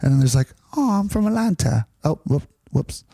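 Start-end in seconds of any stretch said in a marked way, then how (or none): 0.8-1.9 high mock Atlanta voice; playful Southern-accented introduction. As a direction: put on a Southern accent, pitched up. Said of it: "Same thing in the South".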